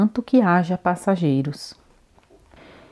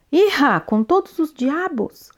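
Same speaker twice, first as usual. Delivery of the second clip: a higher, indignant cry; indignant outburst; 9 semitones higher than usual. A narrator's reading. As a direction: burst out in outrage. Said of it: "indigna-se o corcunda".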